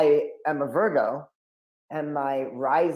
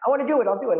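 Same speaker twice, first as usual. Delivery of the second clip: put-on high, louder, eager little-boy plea; eager kid pleading; 9 semitones higher, 5 dB louder, much faster than usual. Begging his dad to let him be an altar boy, high and eager. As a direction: beg your dad to let you serve.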